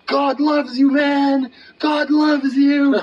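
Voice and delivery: high, loud imitated screaming; joyful scream. Imitating the man pointing back at his benefactor, screaming joyfully. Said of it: "and he's screaming".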